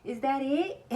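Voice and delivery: high, loud rising question; put-on chirp of a question; mock cashier. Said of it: "she always be like".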